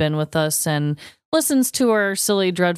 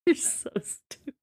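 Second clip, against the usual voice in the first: whispered teasing; 9 semitones higher, 8 dB quieter than usual. Real, not acted. Teasing co-host after joke.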